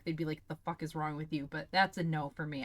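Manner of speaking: usual speaking voice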